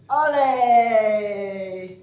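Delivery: loud ringing cry of ole; flamenco ole. Real, not acted. Teacher closes the flamenco demo with a loud, proud ole. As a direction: shout it out like the end of a dance.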